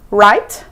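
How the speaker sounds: "right" rising as a question; questioning tag. Shown as a demonstration. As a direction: finish with "right" turned upward. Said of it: "Sempre termina com interrogação".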